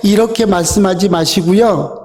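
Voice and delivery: usual speaking voice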